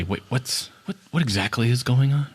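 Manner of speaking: a startled stranger asking quietly; hushed voice. Acted. Unseen stranger questions the party, softly and puzzled. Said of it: "you hear a voice say".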